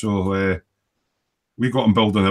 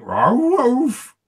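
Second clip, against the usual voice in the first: dog bark impression; 13 semitones higher than usual. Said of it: "it goes like".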